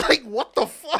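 usual speaking voice

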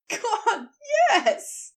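laughing through the words. Acted. Aziraphale laughing helplessly at his own mistake. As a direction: burst out giggling. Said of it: "He laughed full on".

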